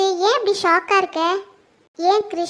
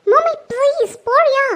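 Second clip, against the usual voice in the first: raised, drawn-out plea to leave; upset plea; 4 semitones higher, 6 dB louder, slower than usual. Acted. Daughter begging her mother to leave, loud upset plea. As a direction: beg loudly and slowly, higher pitched.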